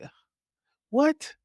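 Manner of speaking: high-pitched baffled "what"; mock disbelief. Podcaster recounting reaction to the next release, mock disbelief. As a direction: act thrown by the news.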